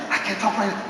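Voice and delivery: mock-urgent quiet phone voice; secretive hush. Secretive call inviting a friend.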